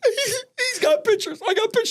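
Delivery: slow, high, laughing sob; mock crying. Son imitating his mother crying about pictures, high and slow. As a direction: wail it high like a tearful mom, half laughing. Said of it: "She's crying".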